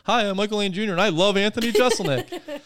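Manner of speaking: mock cheerful intro. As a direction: say it like a cheesy profile bio. Mocking a fake bio line.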